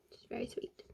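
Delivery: breathy whispered aside; a soft whispered "which is very sweet". Real, not acted. Aside after sweet gesture.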